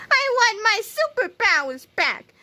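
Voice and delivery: high, whiny cartoon sobbing. A narrator's reading. Melvin begging for his superpowers back, sobbing. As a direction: bawl it high like a thwarted crybaby. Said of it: "sobbed Melvin".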